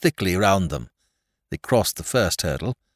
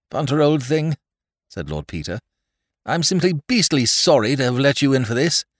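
high, strained sorry plea; strained apology; 7 semitones higher, 3 dB louder than usual. Acted. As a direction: apologize with failing strength. Peter apologizing while sinking.

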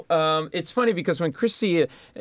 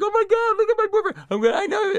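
much higher, squealing with laughter; panicked girlfriend; faster than usual. Retold or imitated. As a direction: play the hysterical girlfriend, half laughing. Girlfriend panics at bloody fight.

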